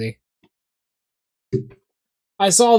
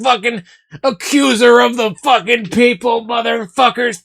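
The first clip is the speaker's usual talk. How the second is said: mock furious rant; 4 semitones higher than usual. Streamer ranting at potato-eating chat, mock fury. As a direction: rant like an outraged zealot.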